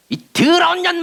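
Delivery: high, sudden abusive outburst; mock exorcist's rebuke. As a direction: imitate a harsh exorcist cursing. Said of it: "축사 사역을 할 때도".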